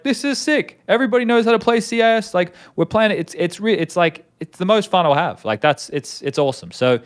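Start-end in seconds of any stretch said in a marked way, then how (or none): 0.0-5.4 much higher, buzzing with joy at queuing with pros; gushing like a thrilled fan; excited gamer. Retold or imitated. Player gushing about playing with the best, excited and high. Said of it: "And it's like".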